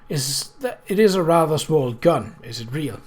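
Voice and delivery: usual speaking voice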